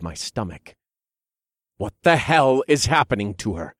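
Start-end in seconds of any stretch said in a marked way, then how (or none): 1.8-3.7 demanding. Acted. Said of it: "I demanded".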